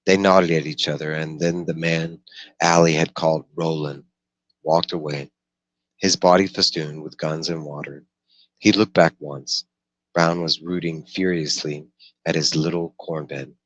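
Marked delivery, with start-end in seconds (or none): none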